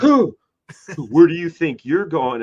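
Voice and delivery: a hint of a gruff guard voice; lightly voiced blocking guard; tough bouncer. The bouncer stopping him at the door, mock gruff.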